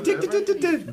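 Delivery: high clicking ticker imitation; ticker noise. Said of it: "it comes in on a ticker".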